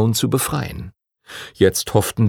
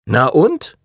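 a mild, lightly raised challenge; called out; 6 semitones higher, 4 dB louder than usual. Acted. Timothy calls out to the teacher, mildly raised. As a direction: toss it out boldly. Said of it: "rief Timothy".

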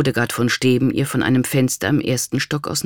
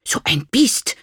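high-pitched hissed insult; spiteful little hiss; 9 semitones higher than usual. A narrator's reading. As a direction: hiss it in indignation. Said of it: "zischte Berta".

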